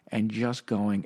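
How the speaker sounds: ordinary delivery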